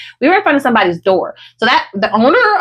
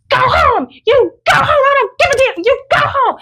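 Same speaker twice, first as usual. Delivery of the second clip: high-pitched yell, re-enacted; retold yelling; 13 semitones higher than usual. Retelling how she yelled from the balcony, high mock yell. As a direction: imitate yelling someone away, pitched way up. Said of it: "that's all I'm yelling".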